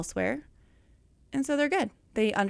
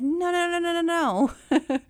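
high, eager no no no; cutting off a client's apology with excited protest; emphatic no; 8 semitones higher, 3 dB louder than usual. Retold or imitated. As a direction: wave off her worry, excited and much higher. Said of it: "And I'm like".

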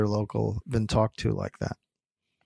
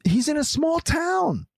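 exasperated shout. Real, not acted. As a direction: shout it in disbelief. Heated argument about Dean.

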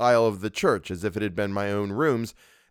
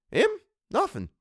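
higher-pitched Cockney reply; Cockney dialect. A narrator's reading. Servant answering his curious employer, in Cockney dialect. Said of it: "Thomas's Cockney dialect".

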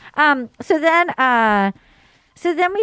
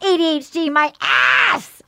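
higher, hammed-up dismissive outburst; mock fed-up outburst; 3 semitones higher, slower than usual. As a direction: imitate an exasperated rant without shouting. Retold outburst of Shanae.

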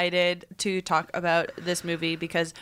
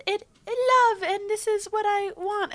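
much higher, slow swooning voice; dreamy teen self; 4 dB louder than usual. Retold or imitated. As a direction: gush like a lovestruck sixteen-year-old. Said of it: "I was like".

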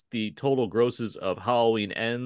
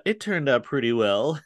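ordinary talk

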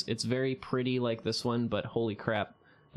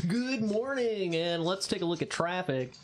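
radio DJ voice; 6 semitones higher than usual. Pretend. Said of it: "my disc jockey voice".

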